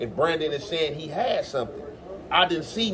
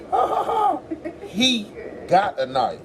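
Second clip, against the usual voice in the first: mock laughing, much higher; 9 semitones higher than usual. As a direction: cackle it high, drag the end. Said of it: "When did anybody ever hear me say that".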